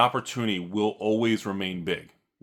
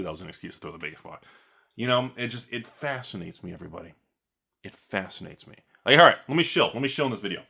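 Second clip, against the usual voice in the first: ordinary talk, nothing marked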